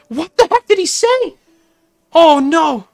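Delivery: loud, high incredulous yell; high-pitched alarmed shouting; shocked outcry. Real, not acted. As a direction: cry out in dismay, pitch soaring. Shocked at what was said.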